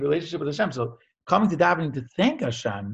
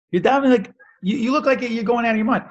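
much higher and louder, a retold burst of alarm; alarmed exclaim; 11 semitones higher, 6 dB louder than usual. Onlookers at the Kaisel exclaim at the sobbing man, high and loud. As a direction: onlookers cry out to the weeping man.

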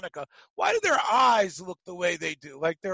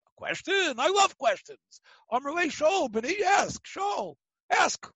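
much higher, bright eager invitation to ask; eager Hillel; 9 semitones higher than usual. Retold or imitated. Hillel inviting the man's question, high and eager. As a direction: welcome the question like a delighted teacher.

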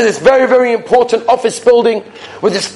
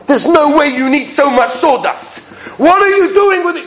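exasperated; 7 semitones higher than usual. Retold or imitated. Exasperated guard confronts thief.

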